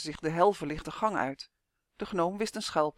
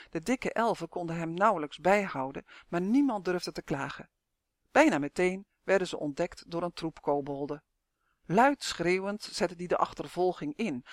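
ordinary delivery, nothing marked

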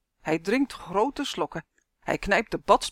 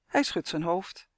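ordinary delivery, nothing marked

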